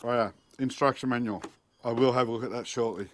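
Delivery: ordinary talk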